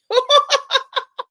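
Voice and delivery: high, breaking laughter. Real, not acted. Streamer reacting to comment on her accent, high laugh.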